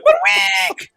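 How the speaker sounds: slow shrieking high squeal; pig squeal. Acted. Teller defending the joke with a pig squeal. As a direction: wail it like a pig.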